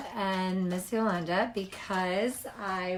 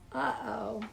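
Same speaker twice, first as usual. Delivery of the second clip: usual speaking voice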